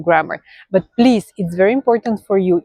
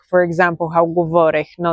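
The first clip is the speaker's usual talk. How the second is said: ordinary talk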